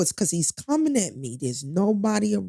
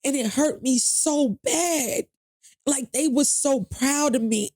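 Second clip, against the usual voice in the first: holding back crying while telling it; near tears; 8 semitones higher than usual. Real, not acted. Telling the host about the lost interview, close to crying. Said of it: "forgive me for getting so emotional".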